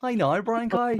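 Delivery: high-pitched Northern Irish demo, "cow" as "kye". Shown as a demonstration. Showing friends the phrase, Northern Irish. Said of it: "for Northern Irish".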